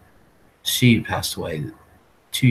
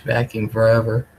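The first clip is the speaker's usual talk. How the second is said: ordinary delivery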